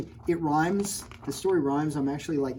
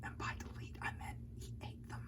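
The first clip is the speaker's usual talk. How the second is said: conspiratorial whispered aside; 17 dB quieter than usual. Real, not acted. Teacher whispering a grim joke to the kids. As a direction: lean in and whisper the dark joke. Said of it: "aside whisper to kids".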